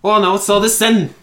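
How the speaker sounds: high, loud attempt at Scottish, slipping Australian; dwarf in a shaky stab at Scottish; bad Scottish accent. Shown as a demonstration. Friend asked for Scottish dwarf voice, bad Scottish accent. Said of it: "do your best Austin-style Scottish accent for the dwarf".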